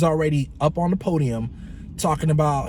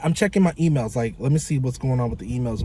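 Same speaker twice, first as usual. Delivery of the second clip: ordinary delivery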